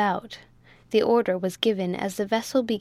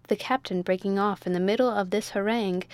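ordinary delivery, nothing marked

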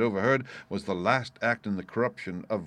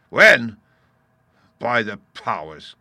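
exasperated cry; 11 dB louder, slower than usual. A narrator's reading. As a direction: burst out, then draw out the oath. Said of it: "cried Silver".

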